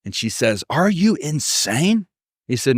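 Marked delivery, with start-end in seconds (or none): 0.7-2.1 high, drawn-out disbelief; slow, high-pitched incredulous question. Retold or imitated. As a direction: stretch out the question in mock disbelief. Wife's incredulous reply in skit.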